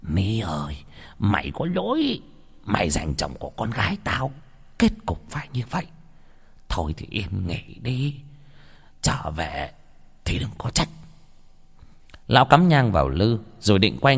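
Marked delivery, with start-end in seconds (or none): 0.0-10.9 hushed plea to the dead; soft, secretive incantation fading to a whisper; murmured prayer. Acted. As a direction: pray under your breath, uneasily. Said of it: "Lão chắp tay thầm khấn".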